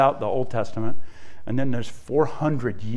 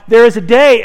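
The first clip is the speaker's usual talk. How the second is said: booming proclamation of the day, louder; proclaiming loudly; 8 semitones higher, 9 dB louder than usual. Acted. Preacher to congregation, voicing Jesus, loud proclamation. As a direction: ring it out like a prophecy.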